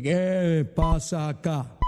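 very slow, drawn-out devil voice; Lucifer. Acted. Devil opening the door of hell, slow menacing role voice. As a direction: boom it out, stretching every word.

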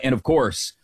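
normal talking voice

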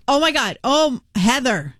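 lightly imitating a thrilled fan; starstruck fan. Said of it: "so she goes".

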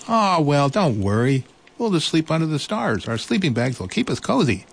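high, kind mouse voice; reassuring little mouse. Acted. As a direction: comfort him in a high little voice. Mouse consoles.

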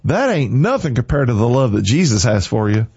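usual speaking voice

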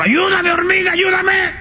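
high shouted cry for help; high desperate shout; donkey's shout. Acted. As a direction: yell up high like a drowning donkey. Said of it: "Y le dijo el burro".

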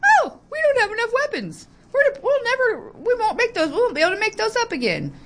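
high, whiny mock voice, far above her own; high mocking impression of a senator on TV. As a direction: sneer it out like a panicky politician. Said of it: "goes on the news and says".

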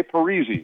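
Parise said "pa-REE-zee"; easy pronunciation. Retold or imitated. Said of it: "it's always easy if you say".

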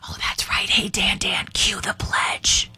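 mock-secret stage whisper; hushed prompt, drawn out at the end. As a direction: whisper a cue to someone off mic. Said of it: "why are we whispering?".

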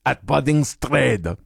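slow mock Croatian accent, flattened vowels. Host performs sponsor line for co-host to guess, slow mock Croatian accent. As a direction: read it like a Croatian, with "Trade" as "Tred".

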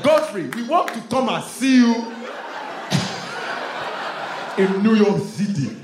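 put-on loud, high eager Nigerian accent; Nigerian cousins. Cousins begging to visit New York, loud Nigerian accent. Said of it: "They be like".

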